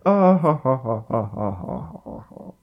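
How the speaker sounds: upper class villain laugh; evil chuckle. Retold or imitated. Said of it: "upper class evil villain laugh".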